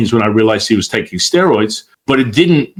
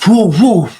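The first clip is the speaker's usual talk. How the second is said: higher, louder startled whoa; 8 semitones higher, 4 dB louder than usual. Retold or imitated. Teacher startled by the channeled threat, alarmed whoa. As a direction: jump back in alarm.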